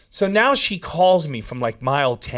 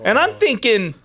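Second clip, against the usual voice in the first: ordinary talk, nothing marked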